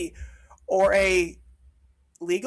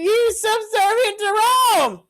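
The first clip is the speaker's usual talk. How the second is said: ranting shout; 9 semitones higher than usual. Real, not acted. Ranting about the history error.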